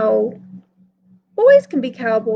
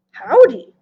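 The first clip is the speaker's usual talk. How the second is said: a louder, lightly voiced cowboy greeting; 7 dB louder than usual. Little Carr greets the hat seller, hint of a cowboy voice. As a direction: try on a cowboy drawl for a stranger, lightly. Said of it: "said in his best cowboy voice".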